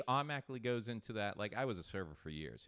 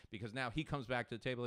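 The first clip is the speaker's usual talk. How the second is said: normal talking voice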